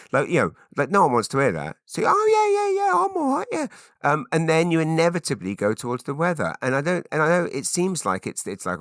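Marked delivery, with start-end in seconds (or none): 2.1-3.7 very high, loud squeal of reassurance; mock-manic small-talk voice; hammed-up cheery reply. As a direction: insist far too brightly that all is fine. Acted over-cheerful answer to small talk.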